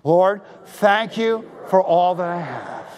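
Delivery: slow unison prayer; slow, deliberate prayer line. Shown as a demonstration. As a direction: lead it slowly, word by word. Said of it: "Come on, say it with me".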